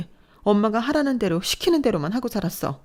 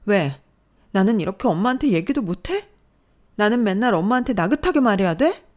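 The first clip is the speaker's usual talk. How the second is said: loud, higher, defiant outburst; trembling anger; 6 semitones higher, 5 dB louder than usual. Acted. Daughter confronting mother, shaking with anger. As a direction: finally fight back. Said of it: "부들부들 떨며 화를 내는 모습".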